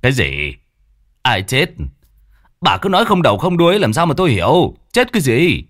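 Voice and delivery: put-on high, loud exasperated old man; irritated shout. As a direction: snap at her for rambling. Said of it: "bực bội".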